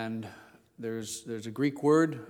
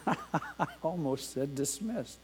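laughing at himself; 6 dB quieter, slower than usual. Real, not acted. Laughs at own slip.

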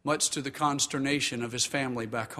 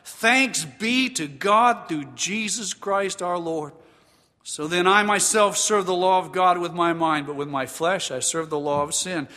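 loud, raised, emphatic sermon delivery; full-force preaching; 5 semitones higher, 6 dB louder than usual. Acted. Pastor exhorting the congregation on struggling against sin, full-force preaching. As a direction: preach it out with force, higher pitched.

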